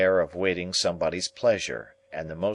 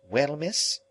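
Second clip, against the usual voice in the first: put-on high, shy boy voice; 9 semitones higher than usual. A narrator's reading. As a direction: answer timidly as a boy. Pip answers Estella.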